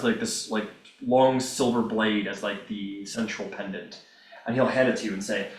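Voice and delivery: normal talking voice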